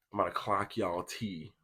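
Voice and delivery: normal talking voice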